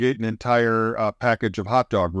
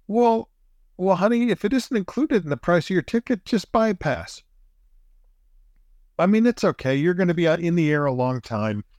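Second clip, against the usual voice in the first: higher, sugary reassuring husband voice; sweet reassurance; 6 semitones higher than usual. Retold or imitated. Husband telling his wife to skip the club, sweetly higher. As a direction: coax her gently, like a patient husband. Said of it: "And I go".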